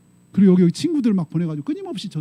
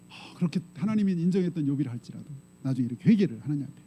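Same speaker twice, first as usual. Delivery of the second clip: ordinary talk, nothing marked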